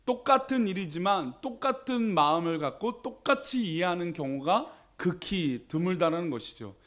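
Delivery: ordinary talk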